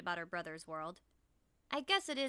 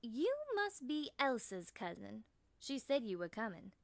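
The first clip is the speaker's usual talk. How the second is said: put-on honey-smooth Southern accent; 2 semitones higher than usual. A narrator's reading. Local woman guesses who the visitor is, Southern accent. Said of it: "she says with a honey-smooth southern accent".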